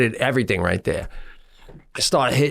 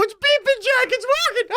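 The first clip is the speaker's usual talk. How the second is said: much higher, squealing with joy; excited squeal. Retold or imitated. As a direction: squeal like the app finally worked. Excited at the working scooter.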